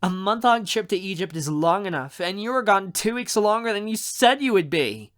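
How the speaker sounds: high, loud mock scolding; pouty reproach to someone dear; playful complaint. Myra scolds him for long absence.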